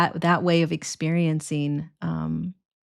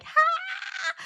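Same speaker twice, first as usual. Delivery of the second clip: a sudden high squealing "Ha!" of delight; gleeful kid whoop; 3 dB quieter than usual. Retold or imitated. Kid on the playground shouting for joy, high gleeful whoop. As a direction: shriek like a happy scraped-up kid. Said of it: "And they're like".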